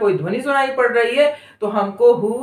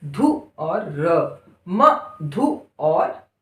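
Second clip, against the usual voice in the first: धु and र drawn out slowly; slow sounding out; 4 semitones lower, much slower than usual. Shown as a demonstration. Teacher to pupils, drawn-out syllables. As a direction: spell it out slowly. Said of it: "उसका अगला शब्द क्या लिखा है?".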